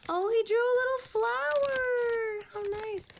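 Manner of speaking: high, sweet coo over a doodle; high-pitched aww voice. Real, not acted. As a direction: gush like it's adorable. Cooing over a drawn flower.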